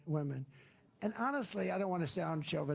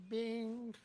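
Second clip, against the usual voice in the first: imitated lift sound; 7 semitones higher than usual. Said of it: "He's going like".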